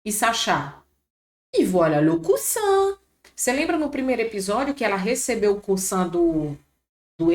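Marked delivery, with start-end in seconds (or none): 1.5-3.0 high, bright acted delivery of the scene line; teacher voicing the character, pitched up. Teacher acting a scene line for students, voice raised.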